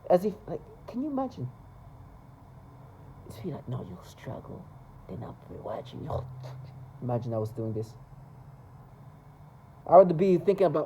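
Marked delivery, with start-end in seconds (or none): 3.7-6.2 whispered, lowered, creepy; mock whispered threat. Imitating neighbors whispering threats at him, whispered. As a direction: breathe the threat like someone lurking.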